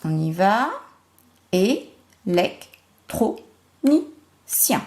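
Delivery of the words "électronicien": "Électronicien" in five short separate beats; split into syllables. Shown as a demonstration. Teacher counting syllables for pupils, "Électronicien" chopped syllable by syllable. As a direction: say "Électronicien" slowly, pausing after each syllable. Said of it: "Cinq syllabes".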